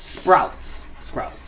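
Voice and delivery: breathy whisper of the word Sprouts; hushed, drawn-out whisper. Pretend. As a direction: whisper it, savoring the name. Echoing store name in hushed voice.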